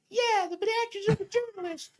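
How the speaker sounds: very high tiny parroting voice; little voice. Retold or imitated. Aide echoing the senator's words, squeaky high parroting voice. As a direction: echo the boss in a squeaky yes-man pipe. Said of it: "the little voice repeats".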